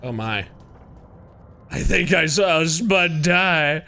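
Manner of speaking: usual speaking voice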